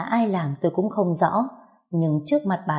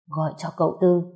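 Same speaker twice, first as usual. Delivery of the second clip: ordinary delivery, nothing marked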